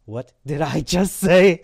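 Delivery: slow, loud, stressed self-questioning; shocked disbelief. Retold or imitated. New convert questioning his own words, astonished. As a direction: draw it out in astonishment.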